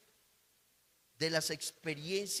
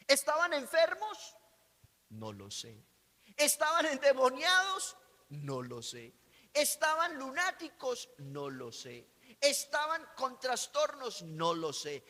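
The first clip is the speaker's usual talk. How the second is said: louder, higher rhetorical questions; preaching questions; 5 semitones higher, 5 dB louder than usual. Acted. Preacher asking the congregation rhetorical questions, forceful preaching. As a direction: fire each question at the crowd.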